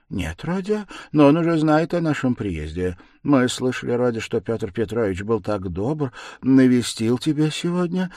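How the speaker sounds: tentative, anxious to inform; a little timid. A narrator's reading. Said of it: "с некоторой робостью прибавила".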